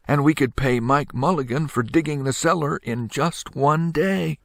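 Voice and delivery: put-on high, eager child voice; a boy proud of his idea; bright little boy. A narrator's reading. Little boy proposing his plan, high and eager. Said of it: "He said".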